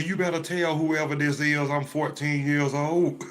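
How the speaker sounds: put-on indignant teen complaint. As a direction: complain loudly to your mother, hammed-up. Indignant teen complains to mother about caller.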